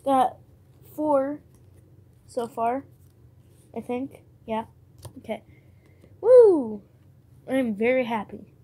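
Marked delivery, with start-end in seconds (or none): 6.2-6.8 high, loud cheer; excited whoop. Real, not acted. Celebrating a good card with a loud whoop. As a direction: let out a victory whoop. Happy with the pull.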